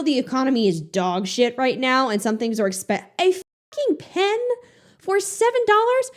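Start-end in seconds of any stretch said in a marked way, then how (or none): 3.2-6.1 high disbelieving squeal, pitched up; outraged high squeal over a pen; incredulous outburst. Real, not acted. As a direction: shriek it in disbelief at the price. Outraged at seven dollar pen.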